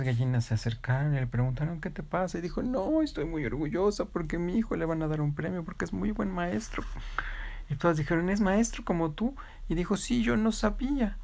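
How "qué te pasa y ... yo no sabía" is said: gentle hens, pitched higher and softer; soft high hen voices. Acted. Proud mother hen and her friends chatting, in soft high voices. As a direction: coo it like gossiping hens.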